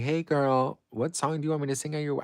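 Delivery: high, fast gushing call to a friend; chirpy high girlfriend voice. Retold or imitated. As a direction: squeal it out like a bubbly best friend. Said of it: "I'll be like".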